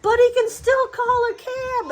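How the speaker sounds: rising to mom's worried objection; mother's protest. Retold or imitated. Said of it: "she says".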